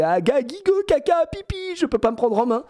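high whiny mock kid voice; a babyish teen whine, poking fun; mocking today's teenagers.

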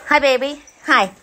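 high, loud cooing hellos; excited pet greeting. Real, not acted. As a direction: gush over the dog through the crate. Greeting another dog in a crate.

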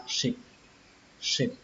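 "ship" dropped soft at the end; short, softened "ship" as a final syllable. Shown as a demonstration. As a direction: let the voice fall away on "ship". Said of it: "chúng ta sẽ xuống giọng".

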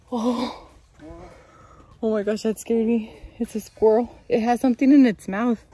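loud, high startled voice. Real, not acted. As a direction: jump at the sudden squirrel. Startled by a squirrel.

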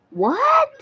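high, rising, incredulous question; astonished. Real, not acted. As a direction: shoot it up high in disbelief. Baffled by a viewer's question. (gulat, mataas na tanong)

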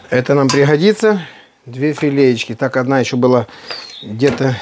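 normal talking voice

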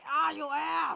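sudden loud high yell at an enemy; startled yell. Real, not acted. Gamer yelling at the enemy in surprise, loud yell. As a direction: shout in shock.

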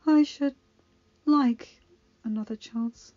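failing, desperate Circe; dying young witch. Acted. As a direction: push the words out through pain. Circe speaks.

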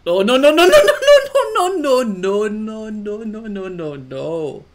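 high, very loud rapid-fire alarm, sliding down; panicked shouting. Real, not acted. Player losing his grip in the game, panicked shouting. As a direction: yell in panic, then let the pitch fall away.

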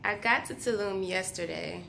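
raspy, soft just-woke-up voice; sleepy voice. Real, not acted. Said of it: "I still have the sleepy voice".

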